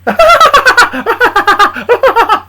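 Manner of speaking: long, high, loud cackle; loud hammed-up guffaw, high-pitched; mocking laughter. Small laughing at Charlie's chicken voice, loud cackle. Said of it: "Small was laughing at me".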